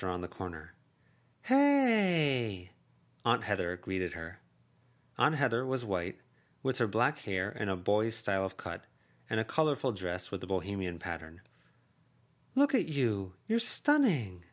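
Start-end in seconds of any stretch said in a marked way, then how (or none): 1.4-2.7 high, loud bright greeting; aunt's delighted hello; excited aunt. Acted. 12.5-14.4 higher and louder gushing; delighted aunt voice. Acted. Aunt admires niece.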